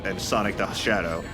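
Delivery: ordinary talk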